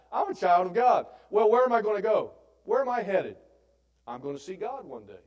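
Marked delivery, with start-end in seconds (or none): 0.1-1.0 proud declaration. Shown as a demonstration. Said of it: "That's pretty great, huh?". 1.3-3.4 preaching. Acted. Preaching at full force.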